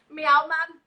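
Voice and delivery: cat asked for a Geordie meow, lightly voiced. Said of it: "my cat says".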